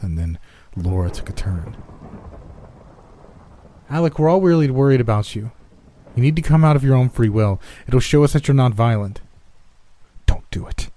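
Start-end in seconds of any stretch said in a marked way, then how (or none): 3.9-9.2 raised, higher voice pleading from outside; calling out. A narrator's reading. Laura calls toward the house. 10.3-10.9 whispered, secretive caution; low-voiced, breath-only warning; hushed warning. A narrator's reading. Hidden voice warns beside him.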